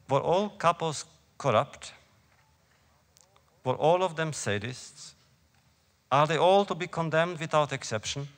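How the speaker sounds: anguished. A narrator's reading. Young student asking about the capos' guilt, anguished. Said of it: "Her voice betrays her anguish".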